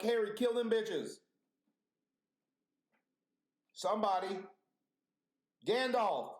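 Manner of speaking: high-pitched mock call for help; frantic plea. Viewer begging Harry to kill spiders, mock frantic. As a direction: cry out to the heroes in comic panic. Calling to film wizards for help.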